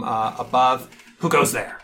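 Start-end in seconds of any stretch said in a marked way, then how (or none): 1.2-1.8 rough call from hiding; gruff voice. Acted. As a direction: bark out a gruff challenge. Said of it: "a gruff voice call out".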